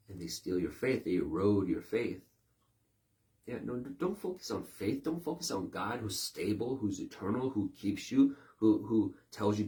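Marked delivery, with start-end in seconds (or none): none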